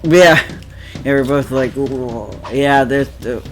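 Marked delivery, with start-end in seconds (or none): none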